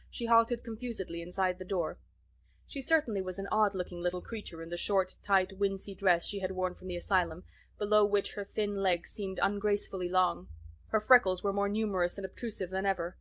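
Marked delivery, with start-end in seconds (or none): none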